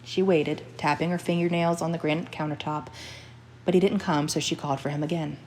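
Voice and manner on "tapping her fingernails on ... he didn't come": ordinary delivery, nothing marked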